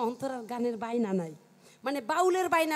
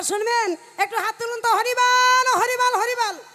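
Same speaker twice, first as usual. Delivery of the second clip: higher and louder, whipping up the crowd; rousing crowd shout; 8 semitones higher, 11 dB louder than usual. Real, not acted. Comedian calling the crowd to raise hands and chant loudly. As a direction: shout it out to the whole audience.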